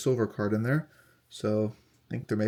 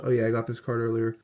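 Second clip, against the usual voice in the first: ordinary talk, nothing marked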